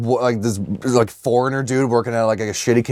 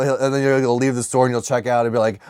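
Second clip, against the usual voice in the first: ordinary talk, nothing marked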